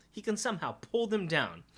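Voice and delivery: normal talking voice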